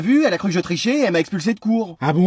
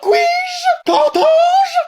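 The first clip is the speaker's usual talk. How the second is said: much higher, pompous shocked cry; theatrical outrage; 4 dB louder, much slower than usual. Acted. Mother reacting to her son's slang with aristocratic shock. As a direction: play a scandalised aristocrat.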